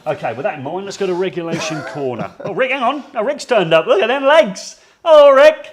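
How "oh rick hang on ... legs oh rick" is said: loud, high excited welcome; excited greeting. Real, not acted. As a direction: call out to a friend arriving. Greeting Rick arriving late.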